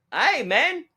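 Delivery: high, loud bad Australian greeting; terrible Australian accent. Actor greeting a starstruck fan, in a bad Australian accent. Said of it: "I just did a terrible Australian accent".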